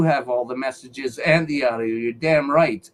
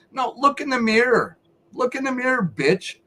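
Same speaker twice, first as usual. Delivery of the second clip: raised, higher scolding; indignant raised scolding; angry rant; 6 semitones higher than usual. Real, not acted. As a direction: tell them off hotly. Ranting at critics online.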